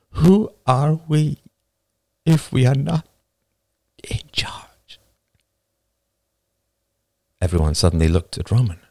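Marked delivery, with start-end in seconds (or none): 0.1-5.0 slow, high, halting plea; tearfully, fading to a whisper at the end. A narrator's reading. Woman questions who they are, tearful. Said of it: "A woman said tearfully".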